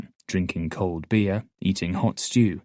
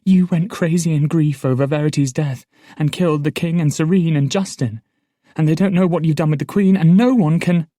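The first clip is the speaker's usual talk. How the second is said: urgent rush; 8 semitones higher, 8 dB louder than usual. Acted. Patience urgently pours out news.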